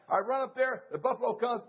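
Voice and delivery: ordinary talk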